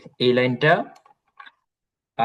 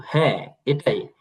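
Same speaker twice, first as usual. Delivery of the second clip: normal talking voice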